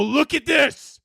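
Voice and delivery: high-pitched, hammed-up yell; shouting at the sky. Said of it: "I start shouting at the sky".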